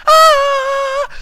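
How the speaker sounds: sudden high yelp out of nowhere. Acted. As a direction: let out a rocky-style shriek. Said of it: "I don't know why I did that either".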